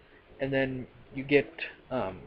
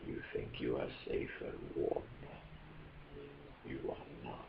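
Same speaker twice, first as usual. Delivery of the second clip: much lower, whispered British menace; deep British Khan voice; 3 semitones higher, 14 dB quieter than usual. Retold or imitated. Khan warning the Enterprise crew, low whispered British menace. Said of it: "in that really deep British voice".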